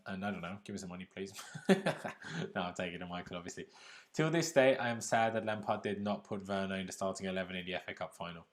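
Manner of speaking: ordinary delivery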